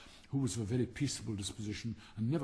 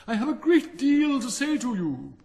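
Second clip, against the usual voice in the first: much higher and louder, slow; desperate shout; 13 semitones higher, 11 dB louder than usual. Acted. Rocket shouting after the duck who ignores him. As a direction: call out insistently.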